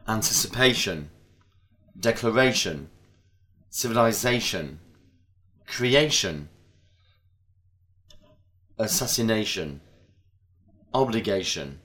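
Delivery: each word stressed on the syllable before last; teacher models -ation words with stress on the penultimate syllable. Shown as a demonstration. Teacher reading a word list for students to repeat, clear stress. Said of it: "Listen, underline the stressed syllable, and repeat".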